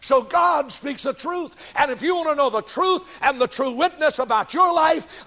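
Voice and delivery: usual speaking voice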